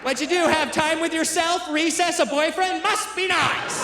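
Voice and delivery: high, sing-song mock of his wife, slowing on must be nice. Comedian mimics his wife needling him in prison: high mocking sneer.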